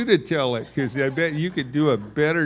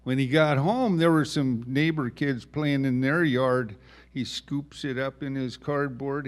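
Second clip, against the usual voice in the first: ordinary delivery, nothing marked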